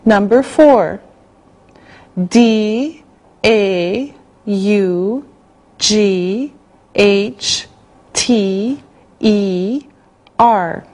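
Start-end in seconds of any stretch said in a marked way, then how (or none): none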